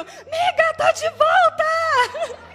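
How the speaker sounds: berro agudo e esticado de comemoração; grito eufórico encenado. Retold or imitated. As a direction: grite a novidade para a amiga, esticando o fim. Reencena ligação eufórica para a amiga. (gritando de alegria, bem agudo)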